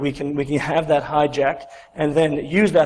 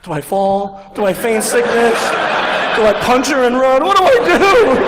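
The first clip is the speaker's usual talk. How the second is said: frantic questions climbing higher and louder; mock panic; 6 semitones higher, 5 dB louder than usual. Pretender weighing escapes from exposure, mock panic building. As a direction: build to a comic high-pitched yell. Frantic inner debate, found out.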